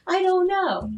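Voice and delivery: high, helpless retold reply; acted-out overwhelmed wail; stressed helplessness. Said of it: "you sound stressed".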